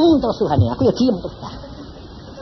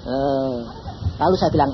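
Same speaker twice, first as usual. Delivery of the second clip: normal talking voice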